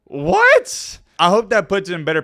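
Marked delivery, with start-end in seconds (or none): 0.1-1.0 very high, loud, disbelieving yelp; baffled high-pitched outburst; incredulous. Acted. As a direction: squawk it in exaggerated disbelief. Mock disbelief at absurd golf excuse.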